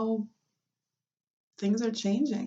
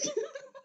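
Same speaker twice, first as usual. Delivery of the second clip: shrieking laugh; 7 dB quieter than usual. Real, not acted. Laugh after mock shout.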